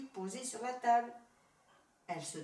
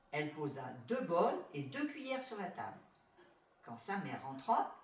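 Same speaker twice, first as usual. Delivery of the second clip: plain reading voice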